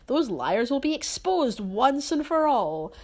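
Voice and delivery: hammed-up villain boast; villain vow. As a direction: declare it like a cartoon villain. Grinch villain declaration.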